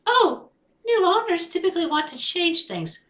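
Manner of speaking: high put-on voice for Rosie; Rosie's voice. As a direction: pitch up into Rosie, brisk. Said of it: "as Rosie responds".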